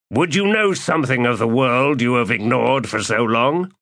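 high, proud, commanding voice; imperious warlord. Acted. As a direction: lord it over the hermit. Calor addresses the Jagut.

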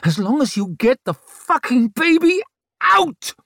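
high snarl building to a screamed last word; furious snarl. A narrator's reading. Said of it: "she snarled".

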